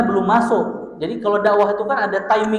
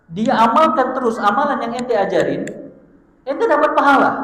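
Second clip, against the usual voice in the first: excited emphasis; 6 semitones higher, 4 dB louder than usual. Real, not acted. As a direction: voice jumps high on the reward. Preacher repeats reward point.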